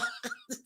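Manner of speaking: high, quiet giggle; helpless little laugh at your own joke. Real, not acted. Laughs at repeating the comparison.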